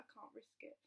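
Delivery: breathy, unvoiced refusal; whispered refusal. Midwife whispers her refusal to the mother. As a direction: whisper it apologetically. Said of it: "She was like".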